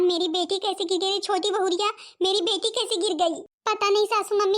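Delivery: ordinary delivery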